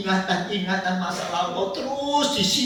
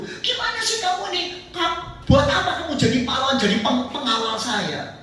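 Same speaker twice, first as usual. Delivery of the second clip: angry king scolding; 7 semitones higher than usual. Acted. Saul scolds his soldiers.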